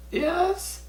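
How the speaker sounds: tiny, very high-pitched squeak; small high nervous reply; timid answer. Retold or imitated. Nervous answer to being called.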